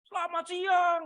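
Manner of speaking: high, drawn-out call across the yard; a kid hollering a greeting from far away; child's shout from afar. Retold or imitated. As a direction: call it out like a hungry schoolboy. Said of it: "dari jauh saya sudah teriak".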